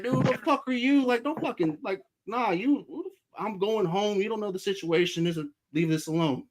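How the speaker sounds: high-pitched, exasperated retold objection; indignant protest. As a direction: re-enact fending off a stranger. Acting out his protest to stranger.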